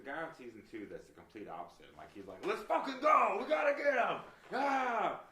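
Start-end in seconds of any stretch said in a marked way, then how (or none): 2.5-5.2 full-blast, high-pitched shout; overly intense contestant. Retold or imitated. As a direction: imitate a hyped-up chef yelling at full volume. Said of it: "a little too intense for cooking".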